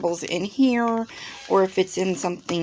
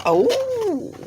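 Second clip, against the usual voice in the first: high surprised squeal; 3 dB louder than usual. Real, not acted. Opening a surprise package.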